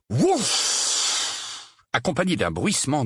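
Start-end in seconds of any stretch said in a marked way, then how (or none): 0.1-1.8 airy, voiceless whoosh; imitated whoosh. A narrator's reading. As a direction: blow out a long puff of air. Owl bursts into the fireplace. (souffle imitant une irruption)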